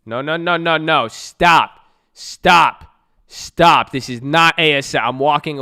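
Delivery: loud, high, exasperated shouting; real loud protest at chat; yelling stop. Real, not acted. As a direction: shout them down, fed up.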